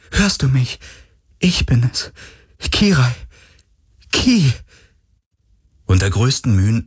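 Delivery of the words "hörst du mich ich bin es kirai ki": plain reading voice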